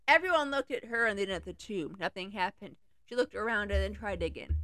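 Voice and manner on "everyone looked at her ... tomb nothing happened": ordinary delivery, nothing marked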